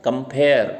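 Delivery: compare with light com, strong pare; stress on second syllable. Shown as a demonstration. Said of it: "कम पे कम stress देना है". (compare में pare पर ज़ोर)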